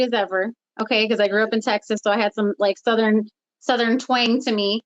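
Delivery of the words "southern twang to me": ordinary talk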